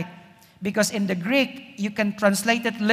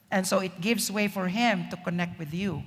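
normal talking voice

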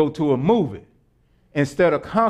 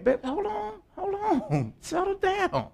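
high, soft, through laughter; hushed, high-pitched chuckling plea; laughing interjection; 14 semitones higher, 8 dB quieter, slower than usual. Acted. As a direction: play at settling someone down while giggling. Laughing while acting out an interjection.

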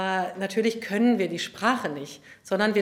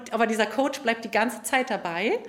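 ordinary talk, nothing marked

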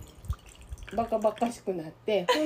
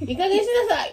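higher, louder scolding "いい加減しなさい" with a laugh; mock scolding half-laughing; 11 semitones higher, 7 dB louder, much faster than usual. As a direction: snap at her, but laughing. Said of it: "ちょっと切れかけて". (市民として秘書を叱りつける、半笑いで)

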